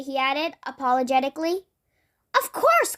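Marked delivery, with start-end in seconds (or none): 2.3-3.0 high, bright first word of the echo; twin's echo talk. Acted. Miss Twin welcomes thirsty Mister Nosy, high and lilting. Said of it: "why she was talking like that".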